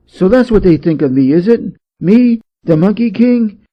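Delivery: wounded pride swelling up; offended Monkey. A narrator's reading. Monkey offended by low rank.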